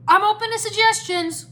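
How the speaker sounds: high, loud retort; exasperated shout. A narrator's reading. Cole replies mid-battle, exasperated shout. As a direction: shout back at wit's end.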